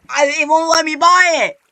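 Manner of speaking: loud, high complaint; frustrated yell. Real, not acted. Gamer blocked from buying in the shop, frustrated yell.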